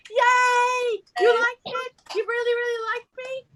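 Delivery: high-pitched playful cheer, loud at first; hammed-up thrill at being liked; gushing delight. Mock delight at audience's approval.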